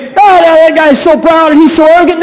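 high, loud mimicked complaint; mock indignant critics. Guys walking away from Jim judging him, mock indignant.

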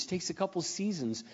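normal talking voice

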